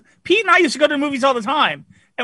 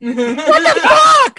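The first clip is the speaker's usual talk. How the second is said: high, slow, drawn-out mock disbelief; imitating Pete's outburst; 10 semitones higher, much slower than usual. Wife imitates Pete fuming at Under the Skin, drawn out and high. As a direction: stretch it out like a baffled friend. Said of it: "Peter's going".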